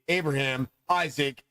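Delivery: ordinary talk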